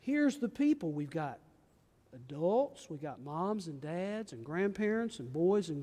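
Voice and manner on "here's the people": higher, bright sing-song line; playful variant. Shown as a demonstration. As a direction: lift it up playfully for the kids. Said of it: "So we might say".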